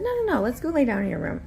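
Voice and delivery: brisk insisting. Retold or imitated. Insisting to toddler.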